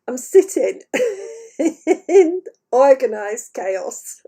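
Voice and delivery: slow, amused delivery split by laughter; laughing. Real, not acted. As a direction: try to explain through giggles. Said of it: "I'm laughing".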